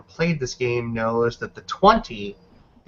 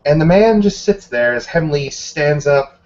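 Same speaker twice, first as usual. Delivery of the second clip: ordinary talk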